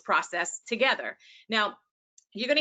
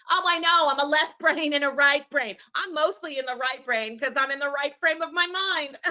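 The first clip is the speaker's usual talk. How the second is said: hammed-up eager student, higher-pitched; mock know-it-all; 4 semitones higher than usual. Teacher mimicking believers in left and right brain myths, mock know-it-all.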